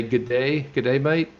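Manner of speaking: playful Aussie "good day, mate" with rising end; Australian greeting. Acted. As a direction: throw out a mock Australian g'day. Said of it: "in Australia".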